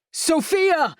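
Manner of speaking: high sudden startled call; startled outcry. Acted. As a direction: blurt the name in alarm. Sudden alarmed call mid-sentence.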